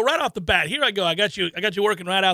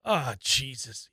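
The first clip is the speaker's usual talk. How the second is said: mock groan of dread; 7 semitones lower, 7 dB quieter, much slower than usual. Husband groans at plans.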